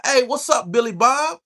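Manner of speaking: high-pitched hillbilly call, lightly mocked.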